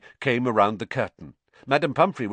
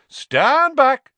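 brisk showman command; 10 dB louder than usual. Acted. Lockhart steps in to fix arm.

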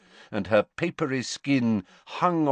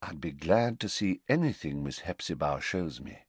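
said quietly; 5 semitones lower, 5 dB quieter than usual. A narrator's reading. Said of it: "said Voldemort quietly".

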